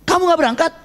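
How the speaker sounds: pertanyaan bernada tinggi, agak heran; Rasulullah bertanya. Acted. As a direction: ask it high, as a leader questioning his follower. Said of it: "Dia tanya". (bertanya dengan nada tinggi)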